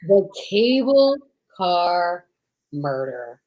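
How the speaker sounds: slow title, dropping low at the end; dramatic title. Acted. Host reading the title of tonight's radio play, slow and ominous. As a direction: draw the title out with menace.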